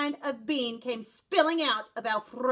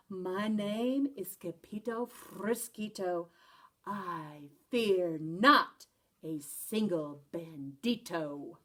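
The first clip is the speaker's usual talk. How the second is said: slow, soft and lower; 7 semitones lower, 5 dB quieter, slower than usual. Acted. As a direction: say it softly, trembling but brave. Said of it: "in a muy, muy soft voice".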